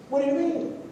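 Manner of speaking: high, soft, puzzled voice of the blunt man; mock puzzled guy. The blunt man asking the pastor, lightly voiced puzzled.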